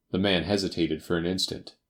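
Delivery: usual speaking voice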